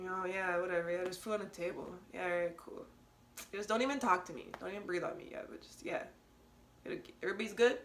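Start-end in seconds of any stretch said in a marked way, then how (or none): 0.1-2.9 mock indifference. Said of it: "look at each other like".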